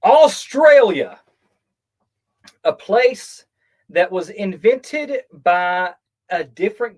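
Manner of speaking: big slow booming Australia; loud drawn-out proclamation; grand announcement. Pretend. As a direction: announce it like a documentary title.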